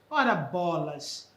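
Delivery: slow, low exasperated grumble; annoyed curse. Shown as a demonstration. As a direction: let the irritation drag out slowly. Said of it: "impaciência ou irritação".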